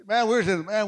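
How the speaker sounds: ordinary delivery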